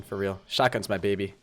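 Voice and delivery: ordinary talk, nothing marked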